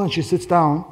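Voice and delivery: ordinary delivery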